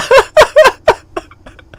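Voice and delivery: very high, loud peal of laughter. Real, not acted. Streamer laughing at a complaint about English R's, loud high laugh. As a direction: crack up loudly.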